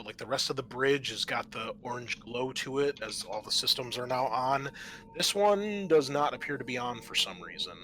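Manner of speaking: normal talking voice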